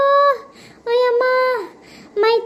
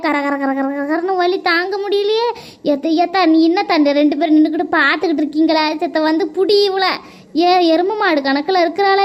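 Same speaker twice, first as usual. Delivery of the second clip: ordinary talk, nothing marked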